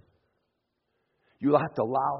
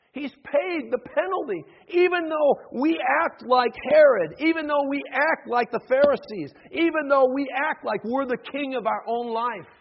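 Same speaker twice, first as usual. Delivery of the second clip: high, loud, rhythmic sermon build; impassioned preacher at full voice; forceful preaching; 11 semitones higher, 10 dB louder than usual. Acted. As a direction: pound out each repeated phrase to the congregation.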